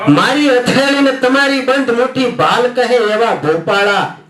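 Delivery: ringing close of the verse; poem refrain. Acted. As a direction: build the refrain, then let it drop away.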